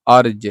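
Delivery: अर्ज said loudly, with a half r flowing straight into ज; teacher calls out अर्ज, stressing the half r; half r sound. Shown as a demonstration. Teacher shows the class how अर्ज sounds, loud and clear. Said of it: "बोलने पर लग रहा है कि र आधा है".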